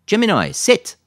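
loud, high-pitched order; firm dog command. A narrator's reading. Lisa makes the Golden sit, commanding. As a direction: call it out sharply to a dog.

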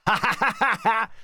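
high, jeering burst of laughter; acted, derisive ha-ha-ha; mocking guffaw. A narrator's reading. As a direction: burst out laughing at someone's expense. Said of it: "s'esclaffe Yvon".

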